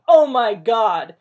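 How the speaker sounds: loud, slow, alarmed yelp; startled yelp. Acted. Rodney caught by the truth field, yelps loudly. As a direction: blurt it out in sudden panic. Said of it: "he yelped".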